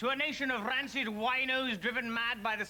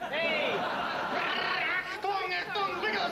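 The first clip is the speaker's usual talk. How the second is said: higher, barked mock German command; mock German shout; 8 semitones higher, much slower than usual. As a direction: bark it like a parody German officer. Ambassador mocking German guest.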